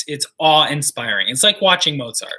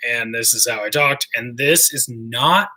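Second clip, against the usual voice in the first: low, forced-down speaking voice; pushed-down voice; 4 semitones lower than usual. Shown as a demonstration. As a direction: press your voice down low. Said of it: "And so I talked like this".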